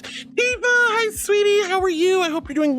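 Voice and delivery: very high, loud sweet hello; squeaky sugary welcome; excited greeting. Real, not acted. Greeting a viewer.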